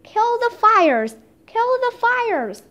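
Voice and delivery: high, drawn-out command; storyteller's horrified call; urgent called order. A narrator's reading. Horrified Leonardo ordering fires put out.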